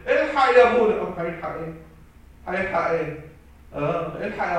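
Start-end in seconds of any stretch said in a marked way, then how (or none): 0.1-1.0 crying out for help, high and loud; a parent wailing to the priest for rescue; tearful plea. Retold or imitated. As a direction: beg the priest in mock tears. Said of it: "تيجي تعيطوا في الاخر وتقولوا".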